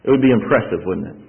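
ordinary talk

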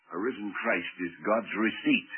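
ordinary talk, nothing marked

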